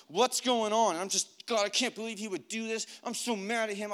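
high-pitched exasperated outburst; worked-up, pleading complaint; angry prayer. Retold or imitated. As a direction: vent to God in agitated disbelief. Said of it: "so angry I could explode".

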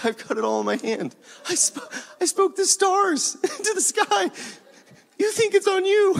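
high, squealing laughter through the words; amused God. Acted. God who spoke the stars, to worriers, high laughing disbelief. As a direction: barely get the words out for laughing.

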